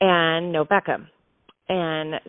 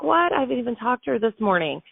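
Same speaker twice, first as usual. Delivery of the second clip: high-pitched, incredulous "what?"; surprised reply; 6 semitones higher than usual. Retold or imitated. Daughter hearing from the helper that mom is unwell, surprised. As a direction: retell a startled reaction, pitched well up. Reacting to news of sick mom.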